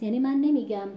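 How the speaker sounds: normal talking voice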